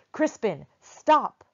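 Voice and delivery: loud, high bellow across the alley; big man thundering a command; thunderous call. Acted. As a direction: roar his name to halt him. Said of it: "only to hear a thunderous".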